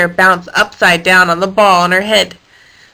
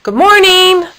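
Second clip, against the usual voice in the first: high, louder call; 9 semitones higher, 5 dB louder than usual. A narrator's reading. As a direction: call out the greeting again. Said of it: "called out again".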